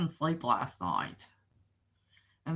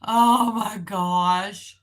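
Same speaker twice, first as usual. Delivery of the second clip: drawn-out laughing oh my gosh; 4 semitones higher, 9 dB louder, much slower than usual. Real, not acted. Laughing at confusing Georgia with Oklahoma. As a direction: stretch it out, then laugh. Laughing at her own mix-up.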